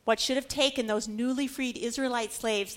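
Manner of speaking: usual speaking voice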